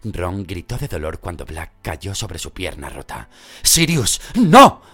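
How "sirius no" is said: high, loud urgent cry; shouted no. A narrator's reading. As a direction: yell to stop him. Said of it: "gritó Lupin".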